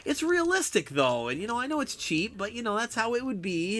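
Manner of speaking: lightly imitating a friend sticking up for a game; defending the game. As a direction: play the friend who insists it's realistic. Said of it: "you'd always try to stick up for it".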